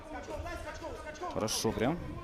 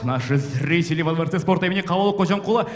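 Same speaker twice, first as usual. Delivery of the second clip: booming ring announcer intro; boxing ring announcer; 9 semitones higher, 10 dB louder than usual. Acted. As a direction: announce the fighter to the arena.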